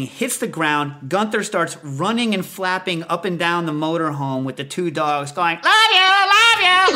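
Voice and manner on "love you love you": very high, loud, slow parrot scream. Retold or imitated. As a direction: screech love you like a panicked bird. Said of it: "they're, like, screaming".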